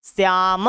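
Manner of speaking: श्याम said as "shyaamah"; श्याम with a Sanskrit h ending; visarga pronunciation. Shown as a demonstration. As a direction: close श्याम on an aspirated "ah". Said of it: "ऐसे हो जाता है".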